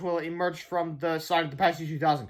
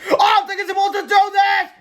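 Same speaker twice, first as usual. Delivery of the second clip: high, loud full shout; panicked shout over a din; shouting over noise; 9 dB louder than usual. A narrator's reading. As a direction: yell to be heard over a racket. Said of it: "shouted George over the horrible noise".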